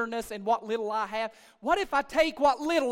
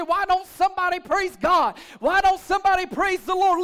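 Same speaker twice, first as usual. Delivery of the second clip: raised, pleading preacher's cry; frustrated shout; 6 semitones higher, 7 dB louder than usual. Retold or imitated. Pastor voicing a frustrated man wanting praise, frustrated shout. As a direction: shout it as an exasperated man would. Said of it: "He's so frustrated".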